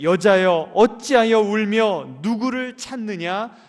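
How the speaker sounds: loud, slow, ringing call of 여자여; proclaimed scripture. Acted. Preacher booming Jesus's words to the congregation, loud and slow. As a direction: boom the words out slowly like a preacher.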